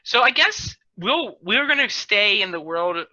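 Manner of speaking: ordinary talk, nothing marked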